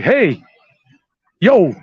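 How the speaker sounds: punchy New York "yo"; New York style. Shown as a demonstration. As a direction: call it out with a New York accent. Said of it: "New York style".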